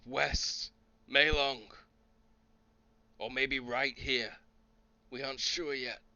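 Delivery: high, loud soldier's warning; urgent calling out. Acted. Soldier warning of a giant monster coming, urgent calling out. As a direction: call it out tensely from a distance. Same soldier calls out the landfall.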